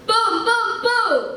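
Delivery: high, loud booming crash words; loud sound-effect narration; crash booms. A narrator's reading. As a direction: punch each boom like an explosion.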